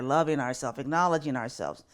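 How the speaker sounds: usual speaking voice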